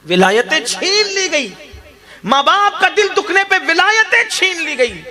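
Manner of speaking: impassioned sermon climax; fiery preaching. Acted. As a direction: hammer the warning home to the crowd. Preacher rises to full force.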